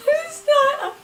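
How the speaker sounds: high, loud crying squeak; tearful, breaking voice insisting it's fine. Retold or imitated. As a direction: choke out a sobbing "I'm fine" way up high. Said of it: "I'm a little dramatic".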